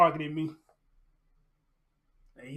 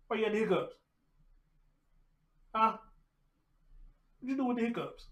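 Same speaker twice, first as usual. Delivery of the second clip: higher, cooing question to the dog; 5 semitones higher, 5 dB quieter, faster than usual. Real, not acted. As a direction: coo at him teasingly. Asking puppy about hiccups.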